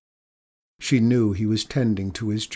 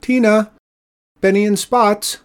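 high, loud summoning call; 11 semitones higher, 9 dB louder than usual. A narrator's reading. Woman at the shore to her cats, calling loudly. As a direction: sing out names to bring them running.

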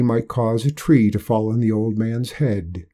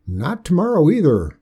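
put-on higher, firm old-timer refusal; stubborn old man; 7 semitones higher, 4 dB louder than usual. Old Hark voiced by narrator.

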